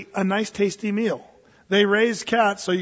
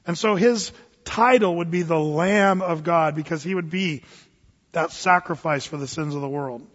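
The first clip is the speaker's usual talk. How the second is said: normal talking voice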